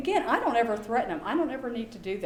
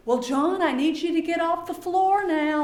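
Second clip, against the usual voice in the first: stern teacher; 7 semitones higher, 6 dB louder than usual. Retold or imitated. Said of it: "get in their face".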